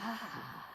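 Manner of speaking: long, soft breathy sigh; push-out exhale. Shown as a demonstration. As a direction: let the breath out slowly. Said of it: "And push it out".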